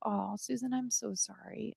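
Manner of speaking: real soft-spoken sorrow for a viewer; soft sympathy. Real, not acted. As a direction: drop to a gentle near-hush for her. Viewer shares near-suicide story.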